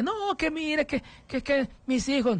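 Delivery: high mock plea, breaking off; whiny mother. Host imitating the mother pleading for her sons, high voice. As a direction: mimic her high stammering defense.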